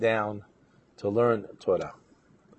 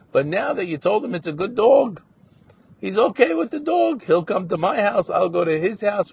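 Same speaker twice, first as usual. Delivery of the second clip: animated, higher-pitched good news; happy relief; 7 semitones higher, 4 dB louder than usual. Retold or imitated. Young man telling the collector of family peace, happily relieved. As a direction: lift the voice as the good news comes. Son-in-law happily tells of reconciliation.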